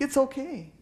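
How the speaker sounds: high-pitched consoling, as if crying along; mock tearful comforting. Retelling comforting the crying victim.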